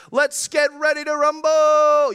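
big ring announcer call; boxing announcer. Retold or imitated. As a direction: boom it like a fight announcer.

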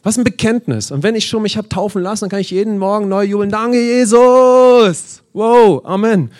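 rejoice out loud at the top of the voice; jubilant praise. Acted. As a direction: ecstatic shout of praise, Danke stretched long. Preacher breaks into jubilant praise.

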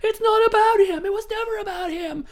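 high, loud mock defiance; defiant shout. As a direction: shout it like a cheesy empowerment line. Heroine's defiant movie line.

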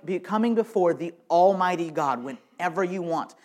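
ordinary talk, nothing marked

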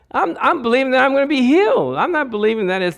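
normal talking voice

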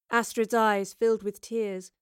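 usual speaking voice

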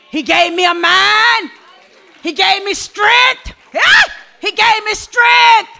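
loud, shouted call to God; full-force preaching. Acted. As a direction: cry out for strength before the church.

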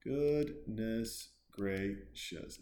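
very slow, syllable by syllable; exaggeratedly slow disbelief; drawn-out exclamation. Real, not acted. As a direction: stretch out "Goodness gracious" with long pauses inside. Repeats it syllable by syllable.

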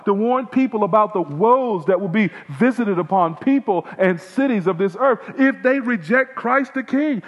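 impassioned sermon voice; fiery preaching. Acted. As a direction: preach it hard to the congregation. Full-force gospel preaching.

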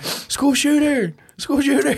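high, loud drawn-out screams; shrieked fake alarm; mock panic. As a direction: scream it like terrified kids, hammed up. Imagines panicked kids screaming.

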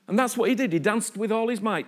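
usual speaking voice

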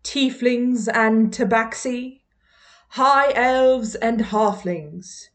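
mock-formal British welcome address; put-on British accent. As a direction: address new students in a stately, hammed-up British accent. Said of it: "that lovely British accent".

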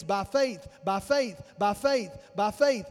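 "by faith" repeated in an even, falling beat; chant-like beat on "by faith", each one set apart; rhythmic cadence. Shown as a demonstration. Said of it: "he's got this cadence".

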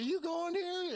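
high put-on greeting voice; surprised question. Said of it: "I said".

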